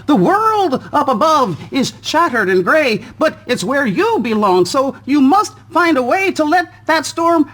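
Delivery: high, airy little spider; a gentle spider guiding from above. Acted. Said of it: "a spider dropped down".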